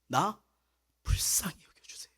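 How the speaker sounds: whispered, pleading prayer to God. Retold or imitated. Preacher recalling a humble prayer to God, whispered plea. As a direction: breathe the plea out without voice.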